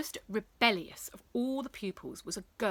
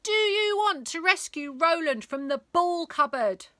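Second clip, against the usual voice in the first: higher, louder rallying call; loud, higher rousing question; rousing speech; 9 semitones higher, 7 dB louder than usual. Acted. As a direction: fire up the crowd. Rebel rousing crowd.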